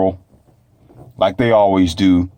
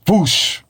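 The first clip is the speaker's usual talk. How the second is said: sharp high whoosh of a speeding car; quick rushing whoosh noise; 10 semitones higher than usual. Retold or imitated. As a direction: sell the car flying past. Car blazing past, imitated.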